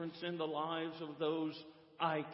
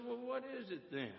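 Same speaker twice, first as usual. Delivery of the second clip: quick, higher, softer puzzled question; lightly acted puzzlement, pitched up; puzzled questioner; 9 semitones higher, 4 dB quieter than usual. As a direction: press for the answer, quick and light. Preacher questioning dog in story.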